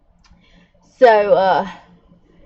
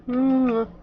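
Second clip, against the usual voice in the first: ordinary talk, nothing marked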